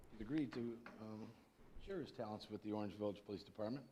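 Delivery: speaking softly, barely carrying; soft voice that doesn't reach the room; too quiet. Real, not acted. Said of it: "Talk louder, please".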